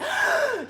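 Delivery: a sudden high gasp of shock; sharp high gasp. Acted. God waking to betrayal, high shocked gasp. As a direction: gasp as if startled awake. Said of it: "Eros wakes up and says".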